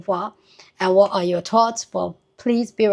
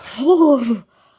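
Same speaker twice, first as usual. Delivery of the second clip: real laugh; 6 semitones higher, 5 dB louder than usual. Real, not acted. Host reacts to the story.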